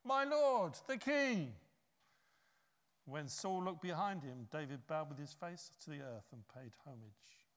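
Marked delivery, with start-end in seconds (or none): 0.0-1.5 loud high call across a distance; ringing respectful shout. Retold or imitated. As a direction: call after him loudly, with respect. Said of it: "called after Saul".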